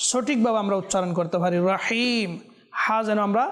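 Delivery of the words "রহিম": ordinary talk, nothing marked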